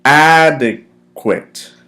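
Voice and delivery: "Adequate" stretched very slowly, loud long first syllable; slow, drawn-out pronunciation. Shown as a demonstration. Teacher leading students together, "Adequate" slowly drawn out. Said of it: "Okay, let's go together".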